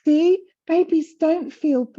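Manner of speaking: high, slow mock-serious voice; sending up the research claim as if quoting it; mocking quote. Quoting the old research to the host in a mocking voice. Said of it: "It said".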